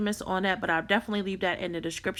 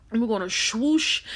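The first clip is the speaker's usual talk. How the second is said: put-on Baltimore accent; 2 semitones higher than usual. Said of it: "in my Baltimore voice".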